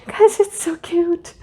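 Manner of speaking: high giggling gush, slowed; laughing delight. Real, not acted. Gushing to viewers over the puppy truck picture, laughing. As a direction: squeal through a laugh.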